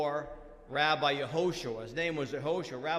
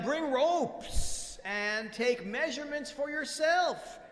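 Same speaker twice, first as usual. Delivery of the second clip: high, projected command; emphatic retort; 8 semitones higher, 4 dB louder than usual. Acted. Rabbi tells wise men to check themselves, emphatic. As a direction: fling the challenge back, pitched high.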